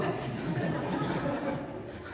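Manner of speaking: quiet airy chuckle; a soft breathy laugh. Real, not acted. Monk laughs picturing himself holding the chappal.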